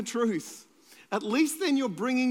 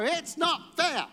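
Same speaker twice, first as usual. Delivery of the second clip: high, slow sulky "It's not fair"; whiny child; 8 semitones higher, much slower than usual. Retold or imitated. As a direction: pout it like a kid to mum and dad. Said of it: "look at mum and dad and go".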